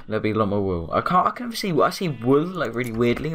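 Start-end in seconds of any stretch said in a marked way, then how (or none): none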